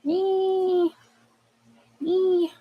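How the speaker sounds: two short high-pitched squeals; delighted squeal. Real, not acted. Squealing at the cards drawn.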